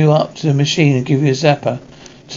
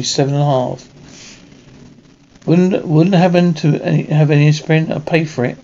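ordinary talk

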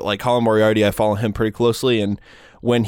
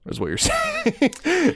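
laughing through the words; much slower than usual. Real, not acted. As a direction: breaks into a high laugh mid-line. Joke about porn job.

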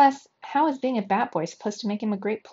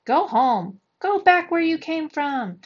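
loud, high jeer from the crowd; heckling; 8 semitones higher, 5 dB louder, slower than usual. A narrator's reading. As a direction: holler it at a player to drive them off. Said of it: "turned to heckling and booing".

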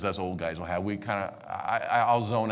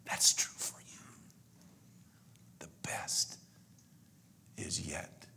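soft, unvoiced whisper; God's whisper; 10 dB quieter, slower than usual. Preacher voicing God's gentle whisper to him. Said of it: "the gentle nudge and whisper of God".

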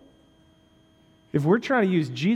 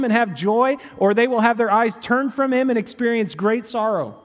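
louder, much higher, driving sermon climb; full-force preaching; 8 semitones higher, 6 dB louder than usual. Acted. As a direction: preach it out to the whole room.

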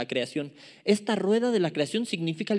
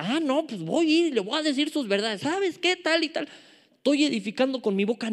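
higher-pitched, worked-up self-imitation; mock confrontational rant; 8 semitones higher than usual. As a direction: act out setting someone straight, pitched up. Imita su propio arrebato al confrontar. (imitando un arrebato, voz aguda)

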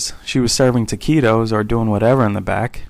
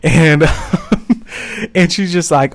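ordinary talk

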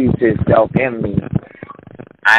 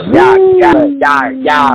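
excited shout; 4 dB louder, slower than usual. Real, not acted. Excited after performance.

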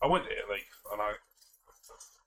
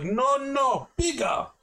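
much higher and louder, insisting hard; serious insistence; 5 dB louder, much slower than usual. Retold or imitated. Tourist insisting to the waiter for a bigger cup, loud and serious. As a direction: put your foot down over the cup size. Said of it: "like that, serious".

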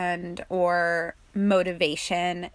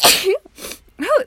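loud sharp cough with a gasp; real cough; 8 dB louder than usual. Real, not acted. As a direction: cough it out, caught off guard.

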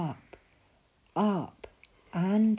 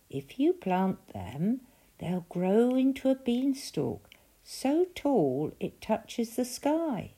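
little man boasting; 8 semitones higher than usual. A narrator's reading. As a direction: spin it like a marvel. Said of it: "said the little man".

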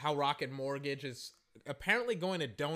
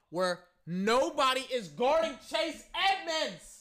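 incredulous shout; 9 semitones higher, 5 dB louder, slower than usual. Retold or imitated. Streamer recounts the wide-open touchdown, shouting in disbelief. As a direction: build to a loud, high yell.

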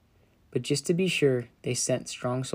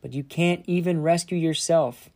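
raised, louder jeering voice; shouted taunt; 4 semitones higher, 4 dB louder than usual. Acted. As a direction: shout it up at the cross in mockery. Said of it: "people shouted".

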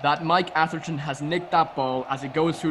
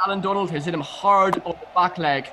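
excited Irish cricket commentary, higher and louder; Irish commentator voice; 5 semitones higher, 5 dB louder than usual. Pretend. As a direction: call the ball in an Irish lilt like a radio commentator. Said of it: "let's bring in a bit of Irish".

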